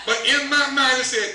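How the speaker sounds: normal talking voice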